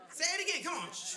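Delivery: ordinary talk, nothing marked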